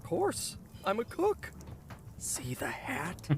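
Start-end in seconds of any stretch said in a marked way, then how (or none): 2.2-3.3 slow whispered boast. Acted. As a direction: breathe it out slowly. Cook boasting about his hat.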